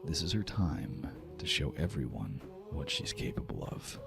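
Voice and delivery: ordinary talk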